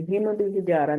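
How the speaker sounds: "हिम बिहिजारा" with the meem cut short; no ghunna. Shown as a demonstration. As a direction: run "हिम" straight into "बिहिजारा" without nasal hold. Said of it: "नहीं पढ़ेंगे".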